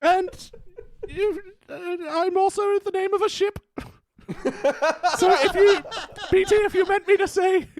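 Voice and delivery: high, very posh Queen Elizabeth voice; Queen Elizabeth impression. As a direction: go way up into a regal falsetto. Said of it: "let's get some Queen Elizabeth".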